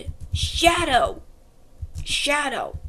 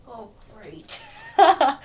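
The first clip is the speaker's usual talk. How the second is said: ordinary delivery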